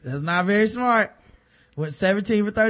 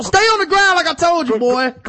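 much higher, louder furious command; Ray Lewis shout; 10 semitones higher, 7 dB louder than usual. Pretend. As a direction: yell him back to the floor. Mock Ray Lewis pins him.

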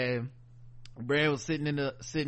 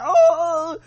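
high, loud crying wail; mock crying whimper; 14 semitones higher, 12 dB louder than usual. Sam bursting into tears in front of her. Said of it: "He was like".

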